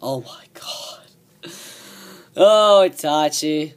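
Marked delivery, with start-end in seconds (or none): 0.0-1.5 slow breathless whisper. Real, not acted. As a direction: whisper it in awe. Reacts to British accent.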